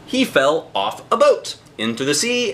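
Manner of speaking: plain reading voice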